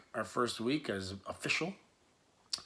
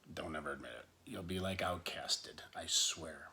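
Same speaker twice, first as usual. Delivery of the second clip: secret whisper; 6 semitones lower, 9 dB quieter than usual. Pretend. To viewers, joking they'd be outcasts, in a whisper. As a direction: hush the words to a whisper.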